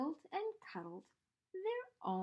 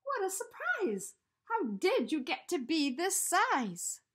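put-on higher, louder boy voice full of wonder; surprised Eddie; 7 semitones higher, 7 dB louder than usual. A narrator's reading. Eddie asks the giant teddy how it grew, amazed boy voice. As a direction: marvel at how huge he is. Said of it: "said Eddie".

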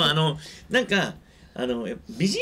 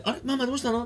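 put-on worried child; 7 semitones higher than usual. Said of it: "子供が泣き出すか".

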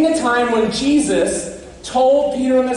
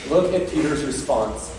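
ordinary talk

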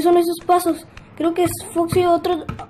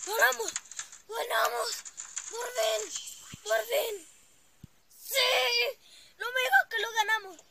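excited cheering; 4 semitones higher, 11 dB quieter than usual. Real, not acted. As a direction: celebrate the win. Celebrating a win. (celebración eufórica)

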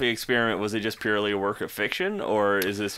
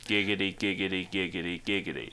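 deadpan Quagmire; 2 semitones lower, 7 dB quieter than usual. Acted. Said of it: "here comes Quagmire. Listen to him".

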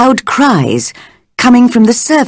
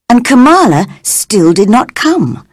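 ordinary delivery, nothing marked

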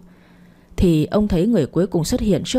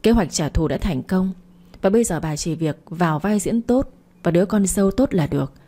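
usual speaking voice

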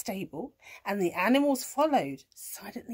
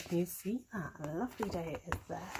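plain reading voice

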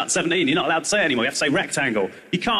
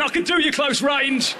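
higher, slow threatening cat; a cornered cat's threat; cat in the corner warning the kid; 9 semitones higher, slower than usual. Retold or imitated.

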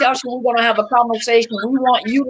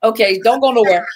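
ordinary talk, nothing marked

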